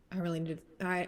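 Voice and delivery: ordinary talk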